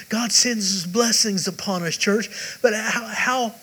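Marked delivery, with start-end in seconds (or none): none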